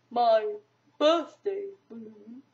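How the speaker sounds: slow, droopy donkey drawl; gloomy Eeyore voice. A narrator's reading. Narrator reading, Eeyore asks Piglet gloomily. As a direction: drag it out like glum Eeyore.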